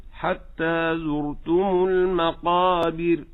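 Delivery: المقابر very slow, ra thinned, stress early; correct stress. Shown as a demonstration. Teacher gives the model recitation. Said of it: "يجعل الأمرين معا".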